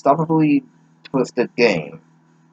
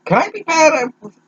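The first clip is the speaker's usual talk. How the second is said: put-on high girly voice. Reading girl's line in voice.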